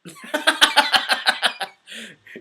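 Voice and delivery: high, loud rolling laugh; real laughter. Real, not acted. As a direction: laugh out loud in a quick run. Reads a funny question, laughs.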